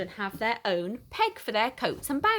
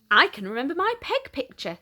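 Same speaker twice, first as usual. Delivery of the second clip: bright, higher and louder with pride; proud; 7 semitones higher, 4 dB louder than usual. A narrator's reading. Boy proudly telling teacher he remembers his peg. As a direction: say it proudly, chuffed with himself. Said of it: "said Tim proudly".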